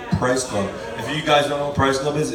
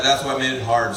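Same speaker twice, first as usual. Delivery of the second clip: ordinary talk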